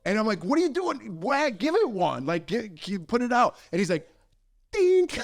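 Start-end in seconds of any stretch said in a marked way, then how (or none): 0.4-3.5 high, exasperated coaxing; exasperated urging. Retold or imitated. Retelling himself urging a friend to whack it, exasperated. 4.7-5.2 loud, high-pitched dink; ping out a high tap noise; imitated dink. Storyteller mimicking the friend's feeble hit, high loud dink. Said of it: "He keeps dinking it".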